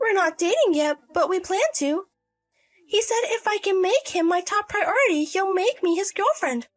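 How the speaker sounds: high-pitched lovestruck daughter; defensive adult daughter. Acted. Daughter tells her mother about the bartender, defensive.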